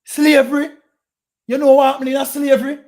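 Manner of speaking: loud, high outraged cry on Slavery, then fast; indignant shout. Real, not acted. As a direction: blast it back in disbelief. Heated street argument about slavery.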